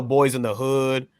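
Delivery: ordinary talk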